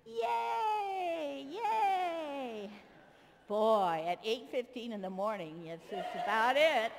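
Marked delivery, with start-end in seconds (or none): 0.0-2.7 high, thrilled yay; excited cheer. Shown as a demonstration. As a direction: squeal it with the children. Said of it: "Let's act excited".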